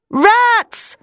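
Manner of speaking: high-pitched cry of alarm; shouted alarm. A narrator's reading. Quincey sees the walls moving, shouted alarm. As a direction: yell a warning, pitch right up. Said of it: "Quincey shouted".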